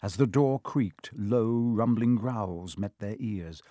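ordinary delivery

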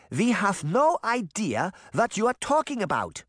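put-on high-pitched accented granny voice; insistent. A narrator's reading. Granny denying the witch's accusation, insistent. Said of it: "Granny insisted".

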